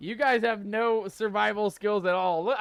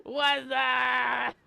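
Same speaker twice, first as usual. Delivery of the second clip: startled cry; 3 semitones higher than usual. Real, not acted. Streamer jolted by something on screen, crying out. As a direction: jump and shout it. Sudden scare in game.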